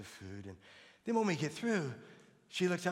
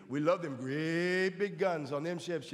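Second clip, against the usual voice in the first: folksy drawl; 2 semitones higher, 7 dB louder, slower than usual. Retold or imitated. Mock hick banter about the Navy.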